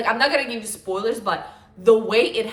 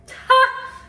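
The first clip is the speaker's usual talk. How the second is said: high burst of laughter; 8 dB louder than usual. Real, not acted. Laughs at own rambling.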